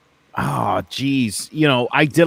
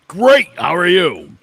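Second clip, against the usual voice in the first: bright put-on cheer, higher and louder; forced cheer; 7 semitones higher, 8 dB louder than usual. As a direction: cover it up with a big fake smile. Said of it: "and you're like".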